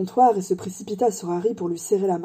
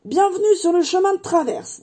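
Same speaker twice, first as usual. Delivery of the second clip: high, ringing announcement; grand welcome; 10 semitones higher, 3 dB louder than usual. Acted. Hagrid showing Harry the hidden street, grand welcome. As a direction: present a wonder with flourish.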